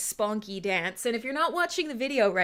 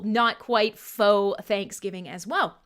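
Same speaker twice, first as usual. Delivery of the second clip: ordinary talk, nothing marked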